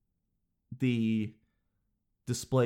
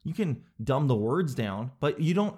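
ordinary talk, nothing marked

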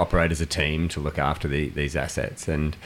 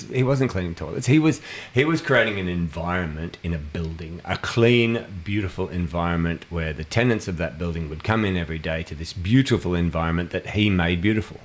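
ordinary talk

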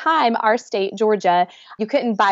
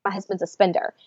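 usual speaking voice

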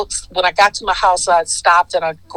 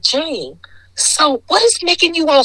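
higher questioning; 9 semitones higher than usual. Retold or imitated. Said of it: "I said".

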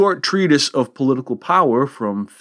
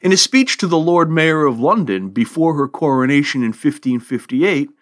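ordinary talk

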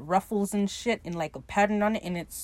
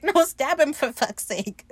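exasperated urging; 6 semitones higher, 3 dB louder, slower than usual. Retold or imitated. Oluwande urging in retold fight.

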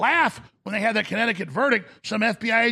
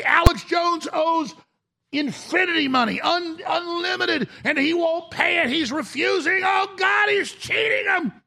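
mocking outcry; 8 semitones higher than usual. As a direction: shout it like a hysterical headline.

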